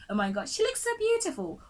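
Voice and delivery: squealed up high, gushing; mock gushing fan. Teacher mocking a starstruck viewer, high mock gush. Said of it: "You're like".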